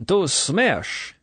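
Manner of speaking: high, ringing drinking cry; hearty toast. A narrator's reading. Colonel demanding the bottoms-up toast, hearty cry. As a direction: call it out to down the glass.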